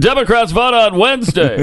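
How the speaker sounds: hammed-up politician making a feeble excuse; John Kerry. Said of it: "[name] does the ... impersonation".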